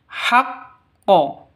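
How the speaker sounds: haq, stop, then echoed qo; doubled q in haqqo. Shown as a demonstration. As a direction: hold the q, then bounce to qo. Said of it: "dipantulkan ke huruf koknya".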